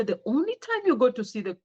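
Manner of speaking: ordinary talk, nothing marked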